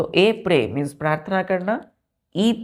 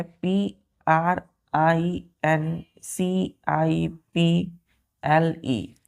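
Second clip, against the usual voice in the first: very slow, letter by letter; slow spelling; much slower than usual. Shown as a demonstration. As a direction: spell each letter slowly for the kids. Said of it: "ध्यान दीजिए". (बहुत धीरे अक्षर-अक्षर बोलते हुए)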